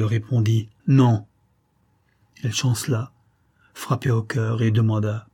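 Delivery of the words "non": 1 terrible refusal. A narrator's reading. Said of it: "Lui, terrible".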